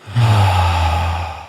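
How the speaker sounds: long, audible breath out; gentle exhale. Shown as a demonstration. Host leading a closing breath, gentle long exhale. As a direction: let a deep breath go slowly. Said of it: "And a gentle exhale".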